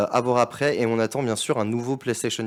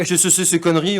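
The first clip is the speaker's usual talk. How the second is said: scoffing publisher; 7 semitones higher, 5 dB louder than usual. Retold or imitated. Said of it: "tout le monde s'est dit".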